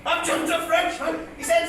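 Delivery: projected, singsong ballad telling; comic poem recitation. Acted. Comedian reciting his dad's old bit as a tribute, declaimed comic verse. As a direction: declaim the rhyme to a crowd.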